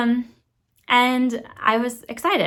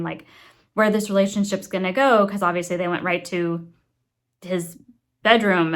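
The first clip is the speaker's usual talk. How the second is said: ordinary talk, nothing marked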